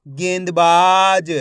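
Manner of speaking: गेंदबाज stretched out slowly as one word; slow blended reading of गेंदबाज. Shown as a demonstration. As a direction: draw out गेंदबाज slowly, joining the syllables. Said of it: "एक एक अक्षर का उच्चारण करके".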